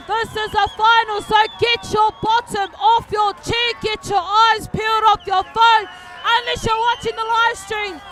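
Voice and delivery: loud, high live play-by-play building to a roar; excited race call. Real, not acted. Commentator calling the waka final to viewers, rising into shouting. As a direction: call the finish like the crowd's on its feet.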